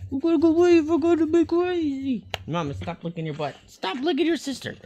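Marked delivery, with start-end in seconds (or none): 0.1-2.2 much higher, louder sing-song at the dog; squeaky pet voice. Real, not acted. Repeats line in squeaky voice. 3.7-4.7 higher playful scold to the dog; mock-scold in a high pet voice; squeaky scolding. Real, not acted.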